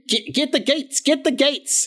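high-pitched, urgent order to the gatekeepers; calling down. Acted. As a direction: call down from the wall, repeating yourself. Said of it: "he calls down".

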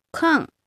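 カン started high and falling steeply as kàn; falling fourth tone. Shown as a demonstration. Teacher contrasting tones to learners, カン falling. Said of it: "四声の下がる方".

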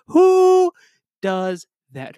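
high, loud disbelieving who; indignant outburst. Real, not acted. Podcaster outraged a friend stood him up, incredulous.

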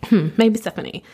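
lightly put-on British accent; fake British accent. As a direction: read it in a mock British voice. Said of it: "we're just gonna fake a British accent".

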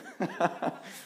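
amused chuckle at own joke. Real, not acted. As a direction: chuckle lightly over the food joke. Jokes about year-end food.

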